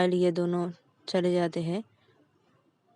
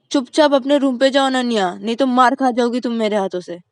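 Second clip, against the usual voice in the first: angry threat; 4 semitones higher, 10 dB louder than usual. Acted. Mom threatens Ananya.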